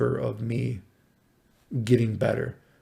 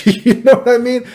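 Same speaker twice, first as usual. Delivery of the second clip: laughing; 11 semitones higher, 9 dB louder than usual. Real, not acted. Laughing at absurd diagnosis.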